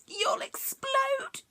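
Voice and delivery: whispered warning of doom; fearful whisper. Acted. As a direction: breathe it out in dread. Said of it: "he said".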